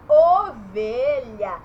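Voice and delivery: "ovelha" slow with a rounded "o"; loud, stretched "ovelha", first vowel like "oh"; closed O sound. Shown as a demonstration. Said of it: "Eu estou falando esse O".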